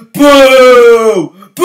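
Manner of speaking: loud high mock booing. Fan retelling his reaction to the suspension, booing. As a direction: boo like an angry crowd member. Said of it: "And I'm like".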